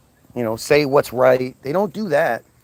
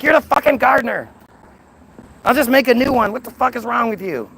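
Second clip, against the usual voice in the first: furious God; 7 semitones higher, faster than usual. Retold or imitated. God throws out the gardener Adam, high furious rant. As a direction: spit it at him like a furious god.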